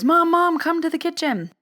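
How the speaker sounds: high, loud call across the house. Retold or imitated. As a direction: yell for mom urgently. Calling mom to the kitchen.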